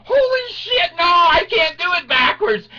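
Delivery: very high, loud comic panic; panicked cry. Acted. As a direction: cry out as the ride lurches backwards. Acted panic as ride reverses.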